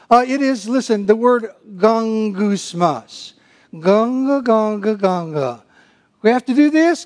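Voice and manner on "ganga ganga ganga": grumbling noise. Shown as a demonstration. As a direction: mumble ganga like a grumbler. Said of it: "a word that sounds like what it is".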